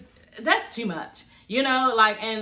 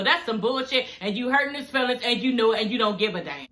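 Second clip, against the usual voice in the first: ordinary delivery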